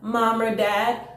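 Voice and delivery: usual speaking voice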